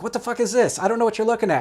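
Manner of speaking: loud, high-pitched mock tirade; exasperated retold yelling; angry dude. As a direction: imitate an angry guy shouting at a stranger. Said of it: "an angry dude in general".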